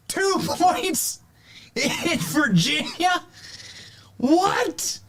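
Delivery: gasping, laughing shout of disbelief; breathless with shocked laughter; out of breath. Real, not acted. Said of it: "I had to catch my breath there".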